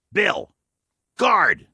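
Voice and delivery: rising to a loud, high call for the dog. Acted. Old man calling his dog to guard the stranger, loud.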